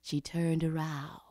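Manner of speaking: low, soft narration fading to near whisper; hushed suspense. A narrator's reading. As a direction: hush it, leaving long pauses. Suspenseful discovery of snake.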